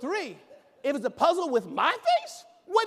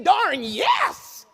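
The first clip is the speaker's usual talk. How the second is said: loud, higher cheer of darn yes; enthusiastic yes; 6 semitones higher, 9 dB louder than usual. Shown as a demonstration. Coaching an audience member to shout it. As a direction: model it with gusto. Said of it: "just say".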